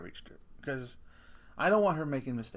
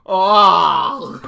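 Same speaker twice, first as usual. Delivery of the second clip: disgusted oh; 4 semitones higher, 16 dB louder than usual. Retold or imitated.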